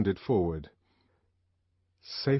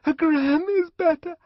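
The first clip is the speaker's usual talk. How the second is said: frantic plea; 8 dB louder than usual. A narrator's reading. Lenina frantic, interrupted.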